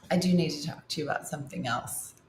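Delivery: hushed and grave; serious tone. Retold or imitated. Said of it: "Complete tone change".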